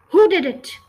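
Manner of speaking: loud, high roar of rage. A narrator's reading. Headmistress roaring at the class after the spill. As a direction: bellow it at the whole class. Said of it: "she roared".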